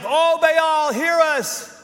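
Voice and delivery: high, pleading cry to Baal; hammed-up desperate prayer; mock pleading to an idol; mock pagan plea. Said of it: "they say things like".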